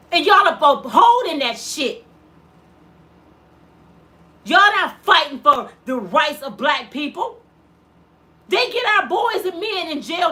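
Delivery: loud, furious accusation; angry shout. Real, not acted. Mother blaming people who uphold the system, shouting angrily. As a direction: shout it at them in real outrage.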